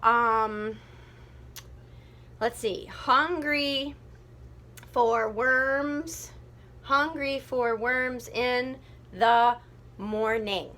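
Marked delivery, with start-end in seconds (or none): 2.9-10.7 slow, halting syllable beats; counting out syllables. Shown as a demonstration. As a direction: stretch it out while counting beats. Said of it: "Hmm, too many".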